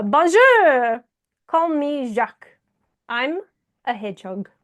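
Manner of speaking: drawn-out French greeting, then gently falling; French accent. Acted. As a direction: introduce yourself with a theatrical French accent. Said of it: "I do the accent as well".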